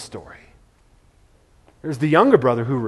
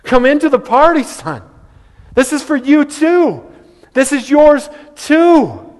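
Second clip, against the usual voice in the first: high, pleading father calling his son in; begging father; 10 semitones higher, 4 dB louder, slower than usual. Retold or imitated. Father begging his older son to come in, pleading. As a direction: beg him to come into the party, higher. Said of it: "He kind of begged him".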